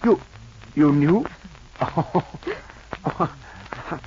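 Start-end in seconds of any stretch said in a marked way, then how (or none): 1.8-2.6 short embarrassed chuckle; sheepish laugh. Acted. Suitor caught out, a short sheepish laugh.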